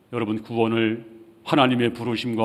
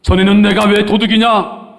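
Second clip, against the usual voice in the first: high, loud acted self-defence; indignant protest; 9 semitones higher, 9 dB louder than usual. Preacher acting the old Zacchaeus denying guilt, indignant protest. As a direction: shout the question in protest. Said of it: "이렇게 변명이 많았을 것입니다".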